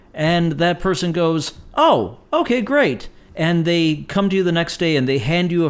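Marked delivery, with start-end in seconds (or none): 1.8-3.1 high, swooping "oh, okay, great"; mildly hammed-up upbeat acknowledgment; acted casual approval. Friend hearing about a favorite pie, acted upbeat. Acted reply to being told a preference.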